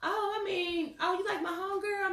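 high, fake surprised friend; mock catty high voice. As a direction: act sweet while throwing shade. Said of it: "she said".

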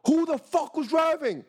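high, drawn-out retold yell; escalated mock police shout; furious police demand. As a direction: bellow the question like an officer losing patience. Police repeat question after silence.

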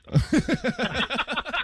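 Superstar stretched very slow, high and loud; drawn-out announcer call. Acted. Cohost hyping a friend, a long, high, loud Superstar. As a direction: draw out Superstar like a ring announcer. Repeats a word, then stretches it.

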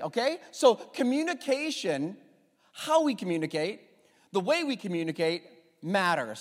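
ordinary talk, nothing marked

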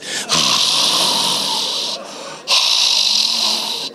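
long unvoiced intake of air; shocked breathy gasp. Seventy leaders hear son's put-down, imitated shocked gasp. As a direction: suck in air in mock shock.

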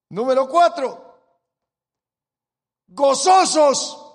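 slow, loud, drawn-out proclamation; full-force preaching. Acted. Preacher driving home the theme to the congregation, slow loud shout. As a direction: hammer the theme home from the pulpit.

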